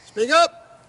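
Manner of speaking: loud, high joke yell; mock shouting. Coach joking back at request for volume, mock shouting. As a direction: shout as if they can't hear you. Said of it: "could be a little bit louder".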